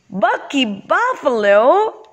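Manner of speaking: high, slow cry of disbelief; astonished exclamation. Acted. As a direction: exclaim the name in amazement.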